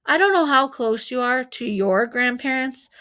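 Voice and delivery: plain reading voice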